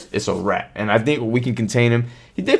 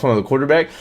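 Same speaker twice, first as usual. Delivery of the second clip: ordinary delivery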